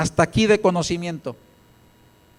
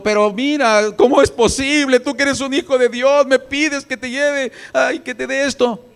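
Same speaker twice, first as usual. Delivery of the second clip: indignant questioning; 7 semitones higher, 3 dB louder than usual. Retold or imitated. Imagined widow questioning the prophet Elías, indignantly. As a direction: question him in high-pitched disbelief. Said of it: "¿A poco le cuestionó?".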